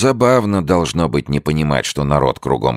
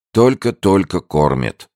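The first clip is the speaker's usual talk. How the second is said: melancholy drawl; 6 semitones higher, 3 dB louder, slower than usual. A narrator's reading. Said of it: "меланхолический, тягучий голос".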